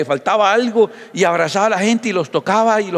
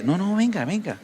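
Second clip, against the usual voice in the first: suave y tierno, casi en voz baja; Jesús tierno; 3 semitones higher, 5 dB quieter than usual. Acted. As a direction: invita con ternura al leproso. Jesús llama tiernamente al leproso. (Jesús llama al leproso con suavidad)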